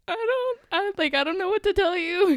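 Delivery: very high, loud retold wail; mock bawling. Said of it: "I just started bawling".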